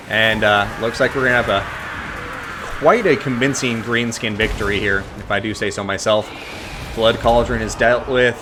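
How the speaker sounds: energetic esports caster voice; live play-by-play. Acted. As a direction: call the battle like a live caster.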